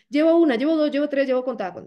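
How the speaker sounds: high, loud hurried rattle; mock hasty recital; rushed telling. Shown as a demonstration. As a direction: reel it off in a breathless rush. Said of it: "no es para contarlo corriendo".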